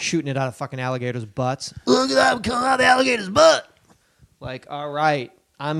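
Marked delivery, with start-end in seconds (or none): 1.9-3.7 high, fast put-on holler; high-pitched mock shouting in a rush; hammed-up excited yell. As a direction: whoop it up like a wild onlooker. Acting out alligator fireworks onlooker.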